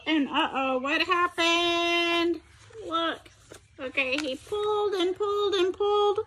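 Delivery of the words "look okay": ordinary delivery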